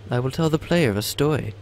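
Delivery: corrected character voice. Acted. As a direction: switch back to the lighter first entity. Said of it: "I probably mixed up the voices".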